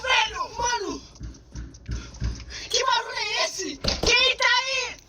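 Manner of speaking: panicked high shouting, real fright; terrified screaming. Real, not acted. Scared by chair thrown in freezer.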